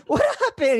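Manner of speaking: high, amused shock; asking the bleeding friend, high and amused; laughing disbelief. Retold or imitated. High laughing disbelief.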